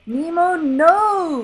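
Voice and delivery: high, loud cry after the boat; desperate shout. A narrator's reading. Father losing the boat, high desperate shout. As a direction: call out in despair. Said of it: "Marlin shouted".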